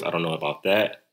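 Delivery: robotic filter voice. Shown as a demonstration. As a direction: speak like a robot. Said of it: "Robot voice".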